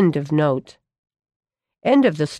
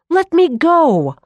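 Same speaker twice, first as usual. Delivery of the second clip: struggling shout; 10 semitones higher, 5 dB louder, slower than usual. A narrator's reading. Boy struggling against a grip.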